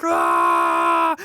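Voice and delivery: high, loud charging yell; battle scream. Retold or imitated. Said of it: "you're just like".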